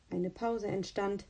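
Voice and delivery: usual speaking voice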